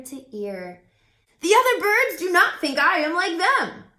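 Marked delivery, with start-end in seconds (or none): 1.4-3.8 loud, high cheerful crow; crow Woof voice. A narrator's reading. Crow introduces himself to Jazz, loud and cheerful. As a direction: play the chatty friendly crow, loud and bright.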